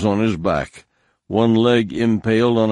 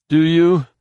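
much higher, louder retort; a dying warrior snapping back; defiant wounded man; 10 semitones higher, 6 dB louder than usual. Acted. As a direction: throw it back at him, strained and sharp. Wounded man throws question back.

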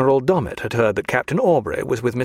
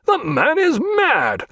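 captain furious at the lieutenant's claim; furious outburst; much slower than usual. A narrator's reading. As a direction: burst out in indignant rage. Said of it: "cried Jack".

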